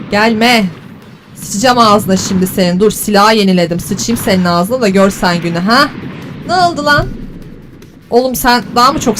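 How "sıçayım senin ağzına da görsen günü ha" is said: usual speaking voice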